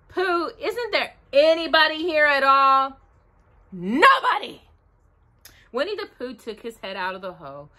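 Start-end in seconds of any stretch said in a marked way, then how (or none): 0.1-3.0 raised storybook bear voice; calling character. A narrator's reading. Pooh calls into the hole. 3.7-4.6 high, loud, curt denial; high-pitched hidden character; voice from the hole. A narrator's reading. Hidden voice answers Pooh.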